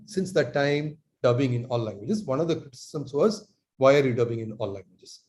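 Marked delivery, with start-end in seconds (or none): none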